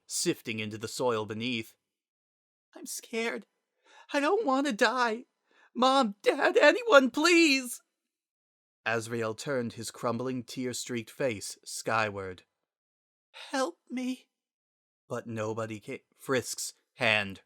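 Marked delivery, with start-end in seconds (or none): 2.7-7.8 put-on a scared little boy screaming for his parents; terrified child begging. Dissolving child begs for help. 13.3-14.3 very high, small voice; a last weak cry for help; faint child plea. Acted.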